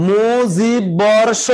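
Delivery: মুজিব বর্ষ stretched slowly, syllable by syllable; slow demo. Shown as a demonstration. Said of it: "কয়টি অক্ষর আছে বের করেন". (ধীরে টেনে উচ্চারণ)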